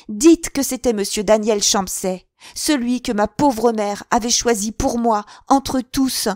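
very loud and high throughout; violent outburst. A narrator's reading. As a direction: hurl the accusation at him. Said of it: "reprit-elle avec une violence convulsive".

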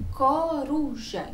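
"coruja" slowly, each syllable drawn out; sounding out syllables. Shown as a demonstration. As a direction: stretch "coruja" to show the opening co. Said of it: "vai circular o C com o O". ("coruja" devagar, alongando as sílabas)

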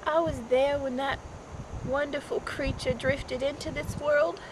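low, quiet, halting recollection; subdued, mournful remembering; hushed grief. Acted. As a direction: let long pauses sit between soft phrases. Mother recounts her daughter's death.

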